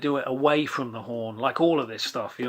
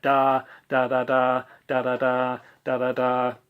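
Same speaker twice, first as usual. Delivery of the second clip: straight spoken eighth notes; plain rhythm count; even rhythm demo. Shown as a demonstration. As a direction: tap out each da evenly. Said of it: "we basically get".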